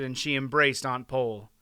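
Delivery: ordinary delivery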